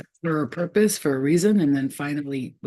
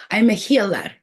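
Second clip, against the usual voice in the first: higher and louder, proud and strong; powerful healer; 5 semitones higher, 6 dB louder than usual. Retold or imitated. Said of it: "She says it like that".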